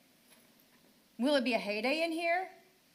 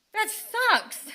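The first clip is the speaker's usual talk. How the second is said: sudden higher, louder burst of disgust; bitter outburst; 10 semitones higher, 6 dB louder than usual. Real, not acted. Mother fed up with the school board, bitter outburst. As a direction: spit it out, fed up. Mother venting at council meeting.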